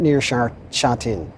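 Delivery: ordinary talk, nothing marked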